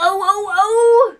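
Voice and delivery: high, loud cries of pain, rising; wailing. A narrator's reading. Queen soon to prick her finger, wailing high. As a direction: build the "oh"s into a squeal.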